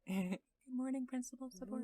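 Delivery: a hint of a movie alien; lightly voiced alien greeting. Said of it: "That's my".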